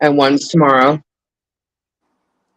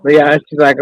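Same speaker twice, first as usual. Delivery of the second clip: normal talking voice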